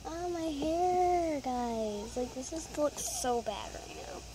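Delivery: ordinary talk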